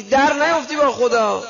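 very high, loud, booming rebuke; scolding divine voice. Retold or imitated. Preacher voicing God rebuking the complaining sinner, booming scold. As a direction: thunder down at the sinner.

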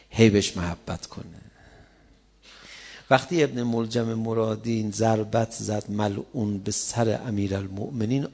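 normal talking voice

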